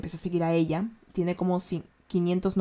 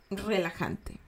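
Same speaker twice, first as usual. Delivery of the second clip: much softer, soothing "relajante"; soft and relaxing; 9 dB quieter, slower than usual. Shown as a demonstration. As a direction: say "relajante" hushed, as if calming someone. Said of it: "y relajante". ("relajante" bajito y sosegado)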